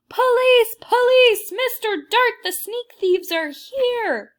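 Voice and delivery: high, loud yell for the police; loudest terrifying shout. Acted. Boy in a painting yelling for the guard. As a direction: scream for help at the top of his lungs. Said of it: "he shouted in his loudest, most terrifying voice".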